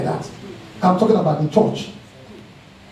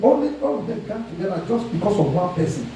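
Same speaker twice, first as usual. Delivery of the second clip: usual speaking voice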